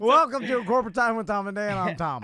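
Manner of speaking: loud, high-energy radio host welcome; show-opener announcement. Acted.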